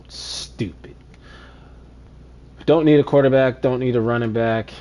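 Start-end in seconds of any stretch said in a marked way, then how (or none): 0.1-1.0 whispered in disbelief; disgusted whisper. Real, not acted. Gamer fed up with the game, disgusted whisper. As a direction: whisper it under the breath.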